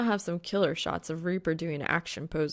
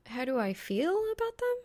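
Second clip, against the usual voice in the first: much higher, puzzled; higher Reaper voice, baffled; incredulous girl voice; 10 semitones higher than usual. Acted. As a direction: echo the question in disbelief. Female character, incredulous.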